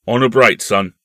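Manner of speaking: loud, firm grown-up uncle voice; hearty uncle. Acted. Uncle giving his nephew his word, loud and hearty. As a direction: pledge it loudly, man to boy. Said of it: "said the uncle".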